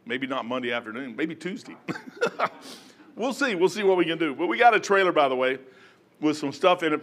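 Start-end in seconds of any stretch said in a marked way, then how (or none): 1.8-2.5 short high chuckle; laugh. Real, not acted. Preacher joking about helping someone move, short laugh. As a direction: break into a brief, soft laugh.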